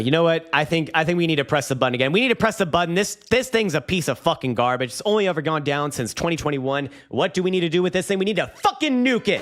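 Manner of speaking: high-pitched, building to a peak on the last line; rally the audience toward hitting the button; hyped-up rant. Real, not acted.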